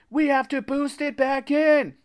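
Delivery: very high, loud straining wail. A narrator's reading. Narrator begs friends to lift monster, wailing. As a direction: wail it in desperation. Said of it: "I wailed".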